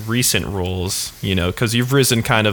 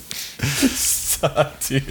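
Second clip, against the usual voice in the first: being tickled; 4 semitones higher, 4 dB quieter than usual. Real, not acted. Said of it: "Trist is tickling me".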